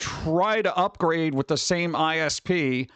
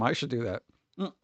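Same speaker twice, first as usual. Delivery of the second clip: usual speaking voice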